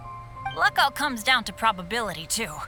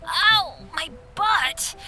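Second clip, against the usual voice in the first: slow, higher cry of pain; pained yelp; 4 semitones higher, much slower than usual. Acted. Boy who just fell on his behind on ice, pained. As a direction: yelp it, smarting from a hard landing. Landed hard after a fall.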